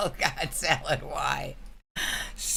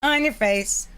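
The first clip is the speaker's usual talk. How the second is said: mock loud; 9 semitones higher, 6 dB louder than usual. Acting a crude text.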